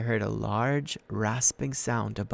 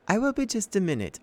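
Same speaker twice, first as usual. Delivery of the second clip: put-on sweet old lady; 10 semitones higher than usual. A narrator's reading. Auntie Em stalls.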